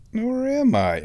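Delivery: teasing question in a high singsong; mock self-doubt. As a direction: pitch it way up, playfully wondering. Playfully doubts own refusal.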